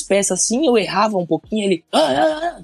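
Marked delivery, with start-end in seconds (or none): 1.9-2.6 high-pitched mock yell at the worker; half shouting. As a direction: imitate the boss's sharp half-yell, pitched up. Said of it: "tipo, meio gritando". (grito meio imitado, agudo)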